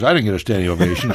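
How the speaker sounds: normal talking voice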